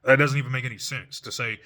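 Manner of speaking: ordinary delivery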